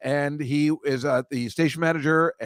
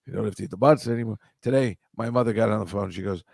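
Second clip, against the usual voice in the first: ordinary talk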